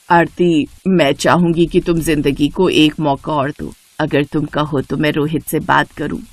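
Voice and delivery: mother-in-law coaxing Aarti about Rohit; gentle mother-in-law. Acted. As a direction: ease her toward a second chance, quietly. Shobha persuades Aarti.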